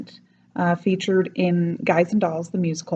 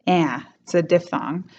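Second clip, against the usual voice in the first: normal talking voice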